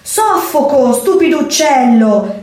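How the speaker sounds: choking kitten yelling at the bird; kitten shouting. Acted. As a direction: kitten yelling from inside the pouch. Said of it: "gridò il gattino".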